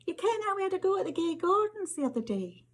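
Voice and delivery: put-on high, quick boyish Scots voice; eager boy. Rory cornering her after geography, high and quick. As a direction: rattle it off like a keen schoolboy.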